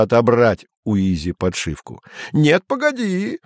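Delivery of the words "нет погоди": высокий протяжный выкрик; выкрикнуть, не отдавая; крик. A narrator's reading. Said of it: "кричал Изя". (крик Изи)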